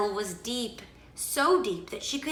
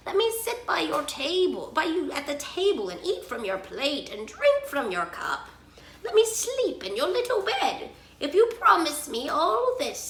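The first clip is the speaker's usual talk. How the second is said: bargaining frog; 6 semitones higher than usual. A narrator's reading. Narrator voices frog bargaining.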